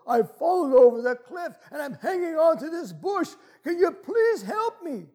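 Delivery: high-pitched urgent plea; acted desperate cry from the cliff; panicked tourist. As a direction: beg for help, voice pushed up high. Tourist pleads for help in joke.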